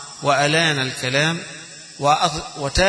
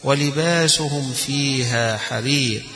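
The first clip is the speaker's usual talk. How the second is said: slow chanted close of the verse, drawing out حرير; Quran recitation; much slower than usual. Acted. Teacher ends the recited verse for his students, slow and chanted. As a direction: chant it slowly and let it fall.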